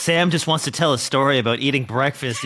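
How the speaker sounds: ordinary talk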